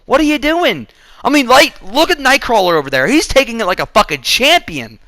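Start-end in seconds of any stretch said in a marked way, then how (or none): 0.0-0.9 higher, slowed, incredulous protest; mock-appalled, as if pleading with the filmmakers; exasperated disbelief. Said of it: "I'm like".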